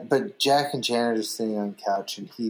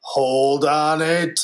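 slow, louder director call; slow louder drawn out; 4 dB louder, much slower than usual. Retold or imitated. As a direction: drag it out, louder. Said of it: "Hoban's like".

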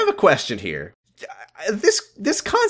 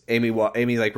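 ordinary delivery

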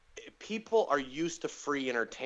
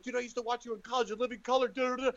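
high, rapid babbling at an idol; amped-up fan; 7 semitones higher, faster than usual. Retold or imitated. Babbling to his idol after the set, amped up. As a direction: rattle it off high, tailing into filler. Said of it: "I was so amped up".

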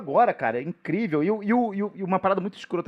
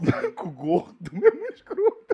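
laughing; 11 semitones higher, much slower than usual. Acted. Mocking list with laughter.

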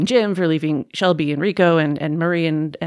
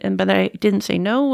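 ordinary delivery

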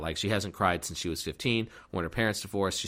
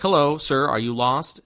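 raised, louder acted hail; calling out helpfully; 5 semitones higher, 6 dB louder than usual. She calls to a lost old man, helpfully loud. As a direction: imitate hailing a stranger.

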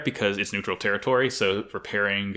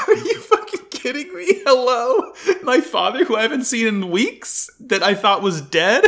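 high-pitched mock disbelief, laughing through it; incredulous outburst; 13 semitones higher, 4 dB louder than usual. Son reacts to his long-lost father's casual hello, incredulous. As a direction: exclaim in stunned, comic outrage. Acts out disbelief at dad's greeting.

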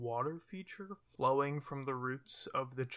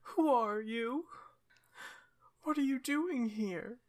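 weepy bear voice; 10 semitones higher than usual. Acted. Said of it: "it looks up at you and says".

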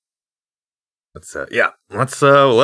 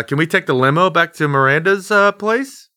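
ordinary talk

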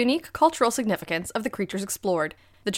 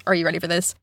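usual speaking voice

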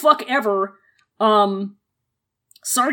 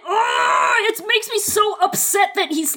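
much higher, fed-up outburst; angry groan; 8 semitones higher than usual. Real, not acted. Angry at Jiggy for manipulating Lan Xichen, fed-up outburst. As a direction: groan out your disgust at him. Ranting about gaslighting.

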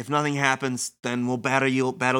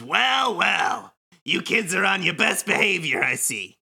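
hammy villain voice; 3 semitones higher than usual. Acted. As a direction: gloat like a cartoon villain. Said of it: "Kingdom Hearts level villain monologue in stupid voice".

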